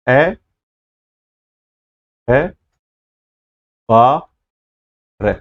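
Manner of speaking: अखबार split slowly into separate syllables; broken into syllables. Shown as a demonstration. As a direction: say each piece of अखबार apart with pauses. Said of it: "इसको टुकड़े में बोलिए". (शब्द को टुकड़ों में, धीरे धीरे अलग अलग बोलिए)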